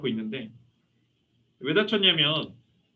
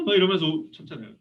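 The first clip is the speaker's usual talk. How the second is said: usual speaking voice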